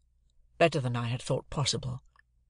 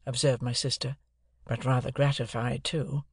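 ordinary delivery

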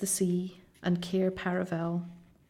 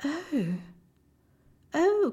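high, dismayed little cries; distressed cry; 9 semitones higher than usual. A narrator's reading. Two girls seeing the broken table, distressed cries. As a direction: cry out in dismay, pitch leaping up. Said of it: "cried the two girls".

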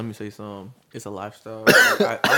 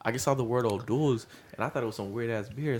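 ordinary talk, nothing marked

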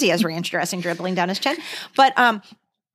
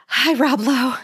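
higher-pitched, dazed fan hello; acted-out awestruck hello; starstruck greeting; 5 semitones higher, slower than usual. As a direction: greet your idol, tongue-tied and smitten.

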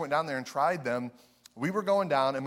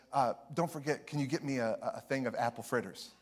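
imitating the aunt, softer; gentle hushed request; aunt quietly asking for fritters; soft-spoken aunt; 2 semitones lower, 6 dB quieter than usual. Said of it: "She has a very soft kind of voice".

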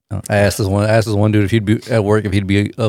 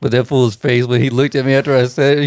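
normal talking voice

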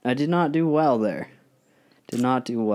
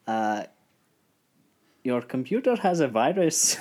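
imitating an Indian scam caller's accent. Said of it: "Love the accent".

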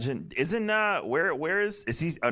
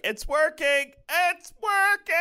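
shrill high excited shout; excited Peli; 4 dB louder, slower than usual. Retold or imitated. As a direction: squeal it like a giddy mechanic. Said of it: "waiting for Peli to be like".

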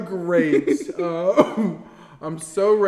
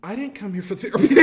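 usual speaking voice

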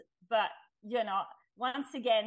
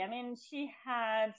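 ordinary delivery

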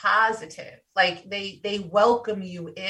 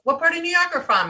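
mock curious stranger; 9 semitones higher, faster than usual. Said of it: "they're like".

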